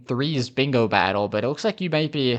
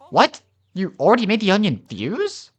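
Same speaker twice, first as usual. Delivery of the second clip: high, loud burst of disbelief; acted startled question; astonished researcher; 8 semitones higher, 4 dB louder than usual. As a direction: react in shock as the researcher. Acted researcher's shocked reaction.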